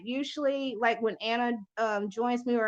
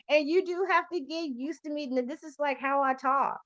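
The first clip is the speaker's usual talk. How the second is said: ordinary talk, nothing marked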